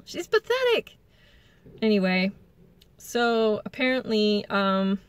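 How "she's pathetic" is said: high, mocking singsong; mocking sneer. Mocking Rachel.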